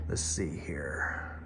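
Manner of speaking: slow, soft whisper under the breath; whispered to self. Real, not acted. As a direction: murmur it to yourself while you check. Muttering to himself while checking.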